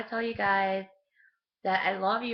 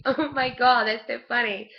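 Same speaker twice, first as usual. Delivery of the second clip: loud, higher laughing oh my God; laughing exclaim; 5 semitones higher, 6 dB louder than usual. Retold or imitated. Telling family about the deer, laughing loudly. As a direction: burst out laughing at the news. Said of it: "I'm like".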